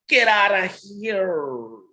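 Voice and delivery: "get out of here" dragged very slowly; drawn-out get out. Pretend. Host teasing caller: very slow, drawn-out dismissal. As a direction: stretch it out in mock disbelief.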